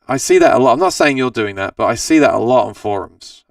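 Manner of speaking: usual speaking voice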